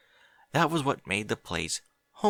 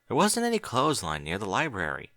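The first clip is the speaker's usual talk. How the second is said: ordinary delivery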